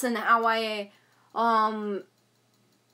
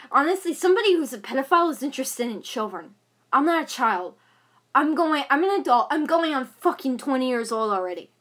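usual speaking voice

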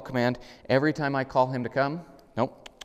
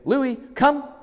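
much higher and louder, crisp; recall command; 7 dB louder than usual. Shown as a demonstration. Trainer calls the dog on the long lead, loud and clear. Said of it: "immediately give him".

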